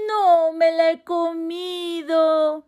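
high, loud, drawn-out mouse cry; joyful shout. Acted. Overjoyed mouse seeing the moon, high loud shout. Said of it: "gritó".

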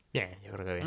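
softly faked confidence, muttered low; a hushed, guilty "all fine"; sheepish lie. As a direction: fob off the parents quietly. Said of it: "mintiendo a mis padres diciendo".

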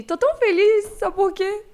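high, loud excited gush. Acted. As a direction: burst with joy. Host gushing excitedly.

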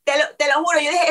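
ordinary talk, nothing marked

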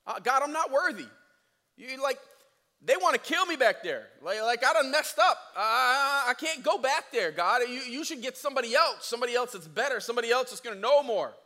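high, loud pleading; whiny excuse. Retold or imitated. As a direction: beg to get out of it. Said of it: "His first excuse is".

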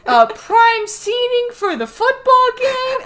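a whiny ape clinging to his treasures; mock Donkey Kong. Friend retells the cartoon, as a whiny Donkey Kong. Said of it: "And he's like".

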